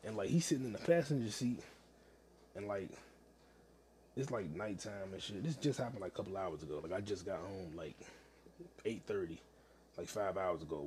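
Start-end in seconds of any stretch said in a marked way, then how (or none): none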